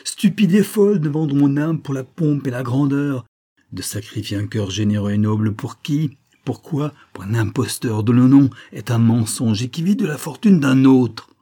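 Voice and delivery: loud, high lament swelling at the end; anguished outcry. A narrator's reading. Olivia regretting the soul she sold, anguished outcry. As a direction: cry out in bitter self-reproach. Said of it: "un ton d'angoisse inexprimable".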